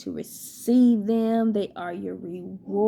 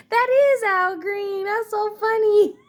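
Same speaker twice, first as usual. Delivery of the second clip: excited; 10 semitones higher, 5 dB louder than usual. Real, not acted. Delighted by coincidence.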